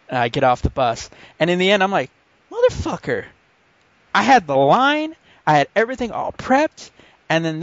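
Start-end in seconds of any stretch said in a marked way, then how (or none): 2.5-3.3 high-pitched exasperated curse; mock frustration. Storyteller who never got to use his line, comically frustrated. Acted frustration at missed comeback. 4.1-6.9 comic indignation. Retold or imitated. Storyteller lamenting his rehearsed comeback, comically indignant.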